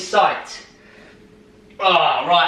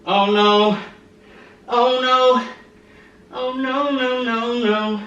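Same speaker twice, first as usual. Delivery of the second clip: strained dread; 5 semitones higher, much slower than usual. Real, not acted. Dreading the burning last reps.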